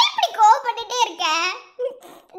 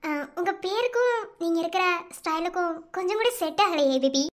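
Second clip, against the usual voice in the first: normal talking voice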